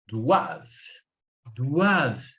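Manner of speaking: doivent as "dwav", ENT left silent; correct pronunciation. Shown as a demonstration. Teacher models the plural verb, "doivent" ending on V. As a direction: stop "doivent" right at the V sound. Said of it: "Você vai pronunciar até aqui".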